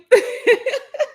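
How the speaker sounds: high laugh through tears; breaking into laughter after almost crying; teary laugh. Real, not acted.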